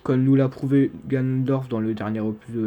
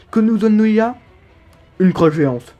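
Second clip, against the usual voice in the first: mock-outraged reveal; 7 semitones higher, 7 dB louder than usual. Comic reveal of the reward.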